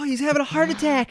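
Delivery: high, loud panicked cry; mock alarm. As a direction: raise the alarm like a frantic relative. Acting out alarmed relatives.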